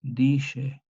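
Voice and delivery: dice with a sh sound, DI-she; old Spanish sh. Shown as a demonstration. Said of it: "como una J antigua".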